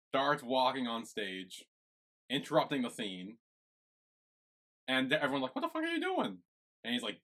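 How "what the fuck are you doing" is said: a stunned, high "what are you doing"; imitating the cast's shock; shocked outburst. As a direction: pitch jumps way up in disbelief. Everyone reacting to interruption.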